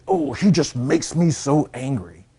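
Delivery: slow, emphatic mock exasperation; mock angry. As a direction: imitate someone exasperated, stretching the words. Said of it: "angry, afraid".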